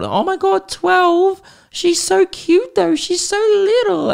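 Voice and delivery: very high, slow, gushing squeal; gushing dog owner. Retold or imitated. Imitating a dog-park owner cooing over his girlfriend's old dog, squealing high. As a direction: coo like a dog lover over a tiny dog. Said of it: "they kept asking me".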